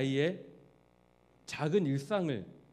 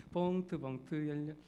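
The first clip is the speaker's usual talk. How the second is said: cute pleading; 7 dB quieter than usual. Retold or imitated. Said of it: "애교 부리면서".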